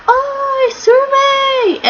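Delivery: very high, drawn-out excited call; Luffy. Retold or imitated. Luffy hailing the Kraken, high imitated call. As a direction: imitate an eager hero calling out in a squeaky voice. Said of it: "Luffy came up and he was like".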